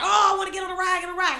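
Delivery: high-pitched imitation of an excited boy; excited kid. Little cousin spots a roller coaster, mock excitement. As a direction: play a little cousin begging for the ride. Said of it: "He got all excited".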